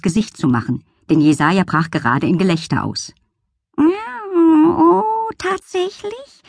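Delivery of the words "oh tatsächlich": much higher, slow, flattered meow; delighted cat. Acted. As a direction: purr it out, charmed. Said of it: "miaute die Katze und strich sich entzückt".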